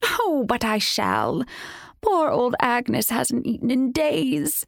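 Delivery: high, slow crone voice; gloating witch relishing her meal; old hag voice. Acted. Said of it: "The hag cackled".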